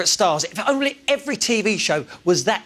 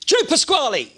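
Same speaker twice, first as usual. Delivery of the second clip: Joe Pasquale impression; 7 semitones higher than usual. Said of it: "please welcome Squeaky Clean".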